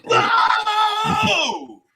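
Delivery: high drawn-out mock boo; berserk crowd booing. Comic imitating the audience booing him, high and drawn out. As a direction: let the boo ring out high. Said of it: "It went berserk".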